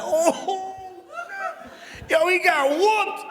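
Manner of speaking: crowd cheering. Retold or imitated. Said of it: "They was like".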